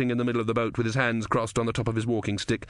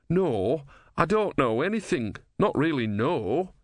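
bewildered novice; 5 semitones higher, slower than usual. Acted. Brother denying he knows anything.

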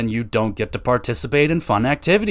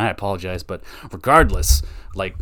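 ordinary talk

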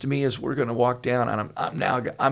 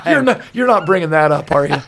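mock alarm; 2 semitones higher, 8 dB louder than usual. Real, not acted. Caught out by son-in-law's story.

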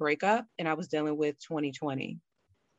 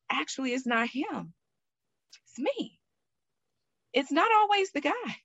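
realization; 9 semitones higher than usual. Retold or imitated. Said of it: "And I'm like".

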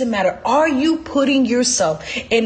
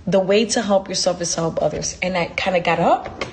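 ordinary delivery

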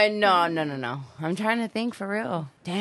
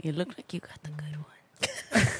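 whisper; 6 dB quieter than usual. Real, not acted. Quiet aside to a friend.

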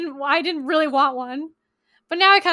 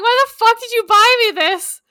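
loud, higher, incredulous outburst; exasperated outburst; 7 semitones higher, 9 dB louder than usual. Retold or imitated. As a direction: blurt it out, loud and appalled. Retold outburst at her partner.

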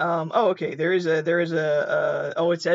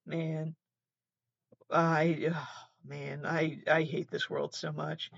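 much softer, worn-out sigh of a line; despairing; 2 semitones lower, 7 dB quieter, slower than usual. Real, not acted. After watching the attack, soft and despairing. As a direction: barely say it, drained by the world.

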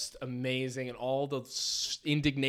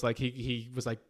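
ordinary talk, nothing marked

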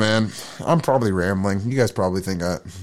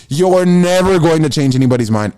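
building to a shout; 5 semitones higher, 6 dB louder than usual. Shown as a demonstration. Repeating the line, building.

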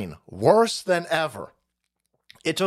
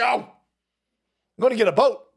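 said out loud and firm, a bit louder; emphatic declaration; 5 semitones higher, 3 dB louder than usual. Real, not acted. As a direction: announce it firmly to the room. Declaring plan, then whispering it.

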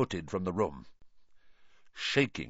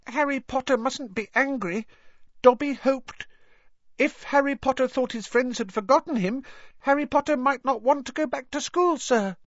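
very high, squeaky elf voice; Dobby, anxious pleading. A narrator's reading. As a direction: explain nervously as a guilty little elf. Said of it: "Dobby blinked anxiously".

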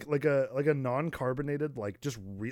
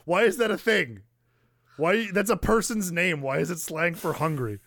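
much higher, louder, indignant ranting questions; mock enraged; 7 semitones higher, 6 dB louder than usual. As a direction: act baffled fury at the slang. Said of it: "it's gonna enrage me".

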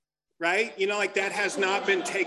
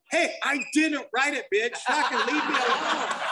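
higher-pitched mock angry outburst; pissed off; 6 semitones higher than usual. Comic retelling old guy stomping out of conference, pissed off. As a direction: imitate a guy storming out in a huff. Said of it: "pissed off".

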